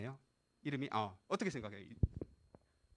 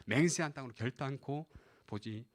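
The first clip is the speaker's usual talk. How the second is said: low, subdued recital of a judgment; God's verdict; 7 semitones lower, 7 dB quieter than usual. Retold or imitated. God barring the disobedient from the promised land, low subdued verdict. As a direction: deliver the oath low and soft. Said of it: "이렇게 말씀하시죠".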